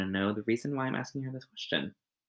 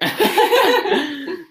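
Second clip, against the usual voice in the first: loud high laugh; 10 dB louder than usual. Real, not acted. Host laughs loudly.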